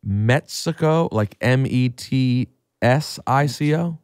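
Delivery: Mexico said slowly as Metsico; slow spelled-out Mexico. Shown as a demonstration. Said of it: "They pronounce it".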